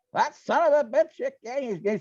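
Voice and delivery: slow, drawn-out high angry outburst; retold angry curse, drawn out and high; furious woman. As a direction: spit it out slowly as the furious customer. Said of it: "she starts going on and on".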